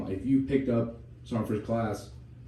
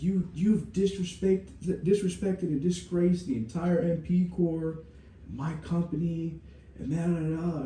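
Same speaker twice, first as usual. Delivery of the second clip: slow, much higher, outraged dressing-down; furious first sergeant; 7 semitones higher, slower than usual. Retold or imitated. First sergeant berating the company, slow outraged rant. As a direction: spell out their disgrace slowly and outraged. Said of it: "He's like".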